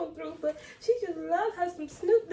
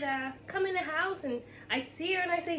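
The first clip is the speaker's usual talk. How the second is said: ordinary talk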